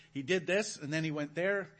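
quick, breathless run of "and immediately"; rushed retelling. Shown as a demonstration. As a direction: rattle through the actions one after another. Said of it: "It's just action throughout the whole thing".

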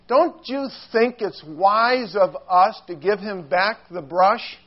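normal talking voice

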